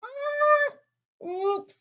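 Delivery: high, startled cartoon oops; a tiny squeak of a slip; squeaky little oops. Bedtime story, little truck reacts with squeaky oops.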